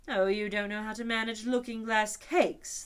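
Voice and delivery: unicorn remarking. A narrator's reading. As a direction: superior advice. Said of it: "the unicorn remarked".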